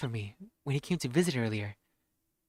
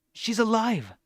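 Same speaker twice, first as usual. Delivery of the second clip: higher, louder cry of joy; excited cry; 7 semitones higher, 3 dB louder, slower than usual. Acted. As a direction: shout it in delight. Repeats in character.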